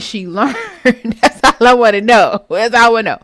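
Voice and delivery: laughing through the words; amused, laughing disbelief. Real, not acted. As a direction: crack up while asking, voice breaking into laughter. Cracking up at her own joke.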